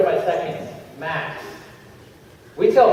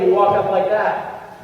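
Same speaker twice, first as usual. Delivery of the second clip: ordinary talk, nothing marked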